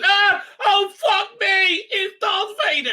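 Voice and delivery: high, panicked screaming shriek; panicked yell. Retold or imitated. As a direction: shriek it like you just saw a monster. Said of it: "he meets and goes".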